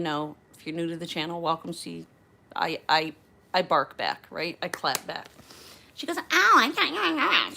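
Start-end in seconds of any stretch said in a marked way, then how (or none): 6.2-7.6 high, loud, dragged-out mimicry; mock someone in a slow, high, jeering voice. Passenger jeers at the flight attendant, mocking. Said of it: "so she's mocking me".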